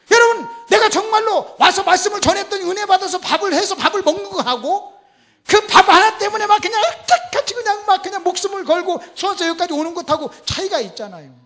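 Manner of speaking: high, loud, impassioned sermon cry; thundering pulpit delivery; full-force preaching. Real, not acted. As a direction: drive the point home to the congregation at full volume.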